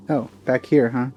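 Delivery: ordinary talk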